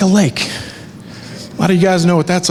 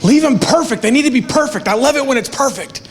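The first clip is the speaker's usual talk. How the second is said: higher-pitched imitation of a ten-year-old begging; voicing his daughter telling him to leave the hearts perfect, childlike higher voice; daughter pleading; 6 semitones higher than usual. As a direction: play the fussy little girl. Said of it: "she's like".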